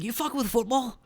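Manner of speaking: put-on high Scottish accent. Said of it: "Like that".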